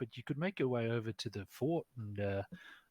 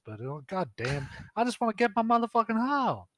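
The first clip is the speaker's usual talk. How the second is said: sassy woman npc; 5 semitones higher than usual. Acted. GM voicing the dancer, ranting to the players in a high brassy voice. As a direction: rant about goblins in a shrill high voice.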